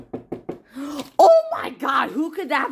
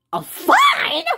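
shocked outburst. Acted. Mario reacting to the fine, shocked cry. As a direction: blurt it out in disbelief.

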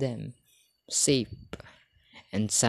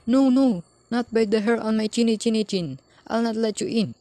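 high squeaky defiant pig voice; squeaky little pig; 10 semitones higher, 5 dB louder than usual. A narrator's reading. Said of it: "squeaked the first little pig".

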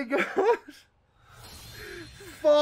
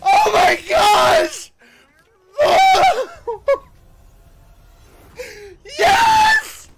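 high, loud, tearful screaming; crying with excitement; 10 semitones higher, 8 dB louder, slower than usual. Real, not acted. Fan overjoyed at the reveal, tearful excited screams. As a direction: scream for joy through real tears. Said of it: "I can't stop crying!".

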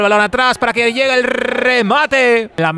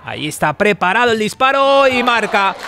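ordinary talk, nothing marked

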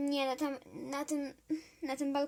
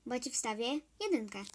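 usual speaking voice

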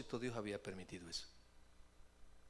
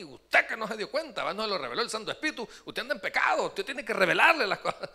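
loud, high angry rant, fast; angry shouting; 7 semitones higher, 11 dB louder than usual. Retold or imitated. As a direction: rage and blame at the pastor. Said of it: "me pegaba un codazo". (gritando enojado, alto y rápido)

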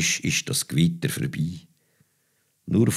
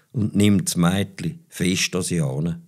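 ordinary delivery, nothing marked

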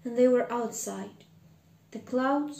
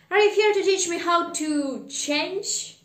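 higher, louder pleading question; urgent question; 6 semitones higher, 6 dB louder than usual. Acted. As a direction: ask the ghost urgently, voice raised. Scrooge questions the ghost anxiously.

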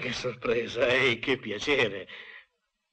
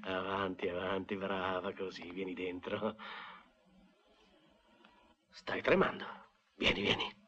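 coaxing; 7 semitones lower, 11 dB quieter than usual. Acted. Coaxing a trembling woman inside.

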